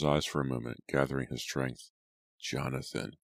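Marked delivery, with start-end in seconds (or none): 2.4-3.2 soft, fading dying voice; feeble, quiet address; weak voice. A narrator's reading. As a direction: say it with little strength, much softer. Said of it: "His voice grew weak".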